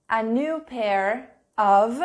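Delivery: "a new pair of" stretched out slowly with a rise; slow model prompt to fill in. Shown as a demonstration. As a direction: dictate it slowly to the class. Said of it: "Vamos ver uma outra frase".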